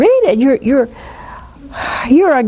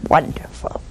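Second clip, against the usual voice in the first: dry sarcastic aside; 8 semitones lower, 7 dB quieter than usual. Acted. Sarcastic reply to the yes.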